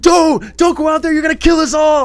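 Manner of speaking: high, loud, frantic warning; panicked shout. Retold or imitated. Reviewer voicing John Goodman warning her, high panicked shout. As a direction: yell it like the world outside will kill you. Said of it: "He's like".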